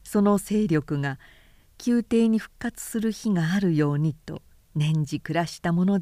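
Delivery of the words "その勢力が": plain reading voice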